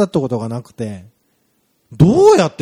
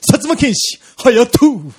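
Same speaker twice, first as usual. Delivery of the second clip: high, loud hero introduction; hero name call; 6 semitones higher, 4 dB louder, much slower than usual. Pretend. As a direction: announce him like a TV hero. Announcing a hero's name.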